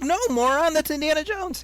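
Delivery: high, loud scornful correction; mock exasperation. Kid correcting gullible people, loudly exasperated. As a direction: set them straight in squawking outrage. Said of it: "I'm like".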